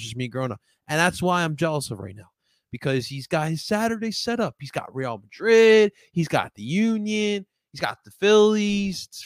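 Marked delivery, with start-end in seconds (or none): 4.6-9.1 excited listing. Real, not acted. Listing friend's great Saturday.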